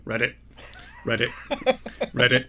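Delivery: croaked "read it" like a frog. Acted. Frog at the village pond, croaking "read it". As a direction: ribbit it out, lightly croaking. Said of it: "And the frog is going".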